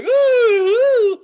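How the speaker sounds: high, loud frightened squeal; shrill ride squeal; scared squeal. Retold or imitated. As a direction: squeal like you're terrified on the ride. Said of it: "she's like".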